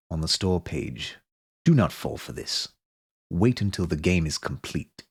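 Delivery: a hint of a grave British game narrator warning the player; mild British narrator impression; Disco Elysium narrator impression. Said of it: "that was my impression of the Disco Elysium narrator".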